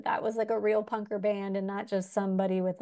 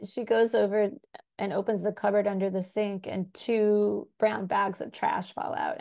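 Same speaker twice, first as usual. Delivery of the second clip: ordinary delivery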